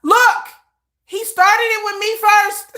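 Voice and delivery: high, loud brush-off; shameless excuse. Retold or imitated. Mother excusing herself to her son, high and loud. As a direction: shrug it off brightly.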